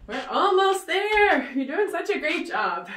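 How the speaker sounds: rally them for the final stretch; excited push. Real, not acted. Coach pushing class on.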